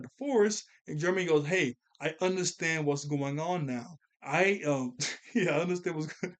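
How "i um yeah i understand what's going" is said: trying to quote through laughter; real laughing. Real, not acted. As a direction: crack up halfway through the line. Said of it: "I'm laughing".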